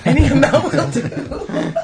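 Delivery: laughing. Acted. Mocking a panhandler's sign.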